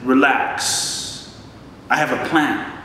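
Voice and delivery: calm and simple. Retold or imitated. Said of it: "Simply said".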